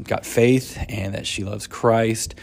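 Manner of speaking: normal talking voice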